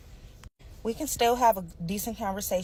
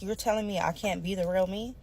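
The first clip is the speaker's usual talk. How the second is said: ordinary talk, nothing marked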